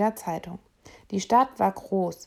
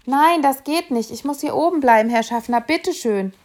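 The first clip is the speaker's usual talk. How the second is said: put-on higher, louder anxious pleading child; a lightly voiced boy begging; 5 semitones higher, 6 dB louder than usual. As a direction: beg the conductor to let you stay.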